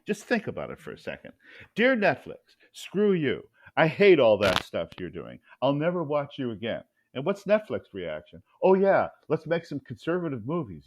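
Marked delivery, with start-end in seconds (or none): 1.8-6.8 loud, hammed-up outrage; mock angry complaint. Conservative cancelling his Netflix subscription, loud mock outrage. 8.6-10.8 louder, higher sarcastic enthusiasm; mock eagerness. Netflix answering an angry viewer, mock eagerness.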